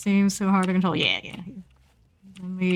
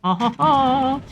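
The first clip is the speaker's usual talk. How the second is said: gleeful chuckle; 6 semitones higher, 4 dB louder, slower than usual. Pretend. Gleeful spotting of survivor.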